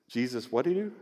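ordinary talk

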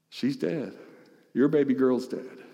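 quiet and heavy, the news of a death; softly delivered bad news. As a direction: let it sink in, barely above a breath. Grim news retold softly.

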